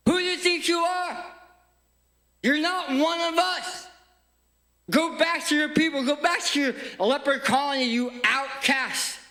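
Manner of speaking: high-pitched indignant yell; angry rejection. Acted. Townspeople casting out the leper, angry high yell. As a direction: drive the outsider away with scorn.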